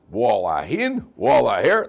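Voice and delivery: loud commanding proclamation; mock miracle command. Rabbi in the joke commands the wall, loud. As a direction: order the wall to part.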